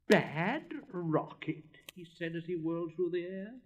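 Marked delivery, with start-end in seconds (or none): 0.1-1.8 loud, very slow, higher indignant repeat. Acted. Rocket flung into the air, loudly indignant. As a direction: stretch each word out in outrage.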